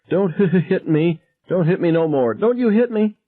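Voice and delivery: very high pleading shriek; terrified scream. A narrator's reading. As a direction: beg not to be hit, shrieking. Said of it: "Irma screamed".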